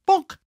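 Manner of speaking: high, punchy comic bonk. Retold or imitated. Storyteller mimics the stonemason's blow, high comic bonk. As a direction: pop the sound effect out like a cartoon. Sound word in retold story.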